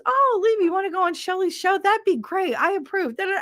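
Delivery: high, sing-song imitation of him; mock cheery husband. Imitating her husband approving the show, high and chirpy. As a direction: mimic him gushing approval. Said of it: "So he goes".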